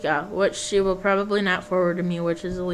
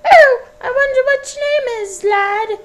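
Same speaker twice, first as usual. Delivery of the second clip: put-on very high, wavering old woman; Scottish woman voice; 14 semitones higher, 6 dB louder than usual. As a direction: coo at the lad in a high, quavering voice. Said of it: "various different accents".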